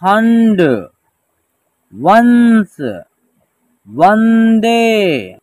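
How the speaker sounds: measured reading-lesson delivery, long held syllables; word-by-word pronunciation drill. Shown as a demonstration. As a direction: stretch each word for learners to repeat. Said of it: "एक साथ उच्चारण करेंगे".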